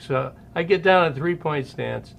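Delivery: ordinary delivery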